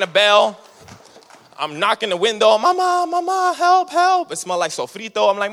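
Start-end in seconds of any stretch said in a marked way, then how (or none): 2.6-4.3 put-on much higher, frantic little-kid cry for help; panicked kid calling. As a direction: play the scared kid at the door. Kid banging on grandma's door.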